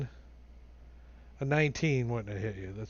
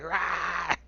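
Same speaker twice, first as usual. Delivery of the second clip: long, higher roar; monster's grabbing roar; 4 semitones higher than usual. Acted. Game master voices the foe grabbing the player, roaring. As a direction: let out a drawn-out attacking roar. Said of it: "She's like".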